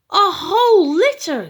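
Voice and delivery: high, loud amazed gasp of words; gasping in surprise. A narrator's reading. Farmer Bluster sees the whole litter, high loud amazed gasp. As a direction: gasp it out in wonder. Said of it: "gasped Farmer Bluster".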